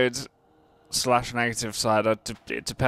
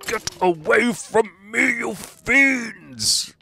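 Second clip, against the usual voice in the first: hammy villain shout; 10 semitones higher, 3 dB louder, slower than usual. Acted. Shouting at monsters, hammed-up.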